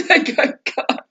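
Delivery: high-pitched, helpless laughter through words; real laughing. Real, not acted. Speaker shocked and amused by Violet's jibe, laughing. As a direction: barely get it out for laughing.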